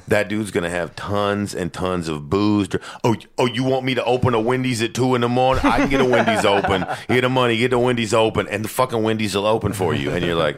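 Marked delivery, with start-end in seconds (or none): 3.0-10.1 higher, louder cocky party-guy voice; mock drunk boaster. Mocking a rich drunk who says yes to every idea, higher and louder. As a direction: ham up a drunk guy promising anything.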